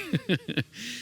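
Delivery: tiny high chicken giggle; a squeaky little laugh, very high. Retold or imitated. Chicken voice ending in a high squeaky giggle.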